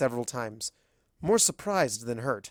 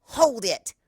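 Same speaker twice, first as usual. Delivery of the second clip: loud, high commanding call; startling shout; 10 semitones higher, 5 dB louder than usual. Acted. Rainbow Dash to Celestia, sudden shout. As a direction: stop them in their tracks. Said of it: "The voice behind me startles".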